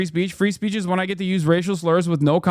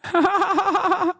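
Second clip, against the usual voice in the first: high laugh; 13 semitones higher than usual. Real, not acted. Laughing at own impression.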